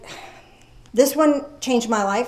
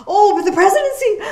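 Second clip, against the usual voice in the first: mock worrier; 9 semitones higher, 6 dB louder, faster than usual.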